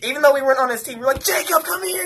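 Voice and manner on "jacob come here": high-pitched retold call to a friend; imitating thrilled friends, voice way up; excited calling. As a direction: call him over for a celebratory hug. Retold cheering friends call him over.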